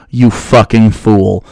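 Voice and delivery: low, slow mock-gravity put-down; slow low scornful jab; mock insult. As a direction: drop the voice and drag it out, sneering. Mocking the troll's clip.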